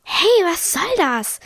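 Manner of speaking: high, drawn-out protest; indignant yell. A narrator's reading. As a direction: cry out slowly in indignation. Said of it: "schrie Emanuel".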